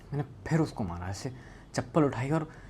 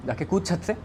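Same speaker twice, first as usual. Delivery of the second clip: raised pitch, not yet shouting; insistent order; 7 semitones higher, 3 dB louder than usual. Retold or imitated. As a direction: press the order home without yelling. First order, before the shouted repeat.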